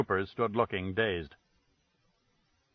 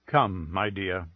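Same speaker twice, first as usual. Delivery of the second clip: gentle; 5 semitones lower, slower than usual. A narrator's reading. Husband gently leads wife away.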